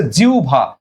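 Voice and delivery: জিহ্বা with a u glide before a single bh; correct pronunciation. Shown as a demonstration. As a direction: say জিহ্বা as "jiu-bha". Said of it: "এই উচ্চারণটা হয়ে যাবে হচ্ছে".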